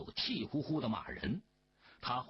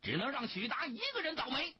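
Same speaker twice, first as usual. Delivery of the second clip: high-pitched, rushed outburst; agitated outcry without much added volume; sudden cry; 10 semitones higher, faster than usual. A narrator's reading. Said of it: "科尔昆突然叫喊起来".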